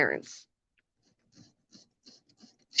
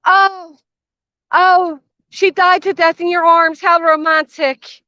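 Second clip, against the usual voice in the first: sarcastic gushing, pitched higher, slowing on "How romantic"; mock swoon; 7 semitones higher, slower than usual. Mocking the woman who called the death romantic, sarcastic gush.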